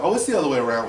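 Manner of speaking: loud, ready answer, lightly acted; without hesitation. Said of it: "without hesitation".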